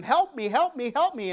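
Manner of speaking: a half-acted scream, not full volume; mock scream for help. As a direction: call it out like she's chasing them. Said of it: "screaming at them".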